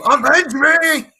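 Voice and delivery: slow, high, loud dying cry; overacted death scene. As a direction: bellow it like a comic-book death. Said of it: "Like it's fucking Spider-Man".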